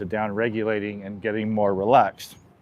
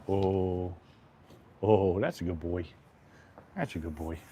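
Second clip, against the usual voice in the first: soft, lower, tender praise for the horse; calm him with a fading hush; much softer cooing to a settling horse; soothing praise; 3 semitones lower, 9 dB quieter than usual. Real, not acted.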